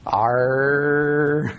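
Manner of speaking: long drawn-out pirate "arr"; playful pirate impression, one held syllable; pirate growl. Shown as a demonstration. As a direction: stretch it out like a pirate, sliding upward. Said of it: "Talk like a pirate".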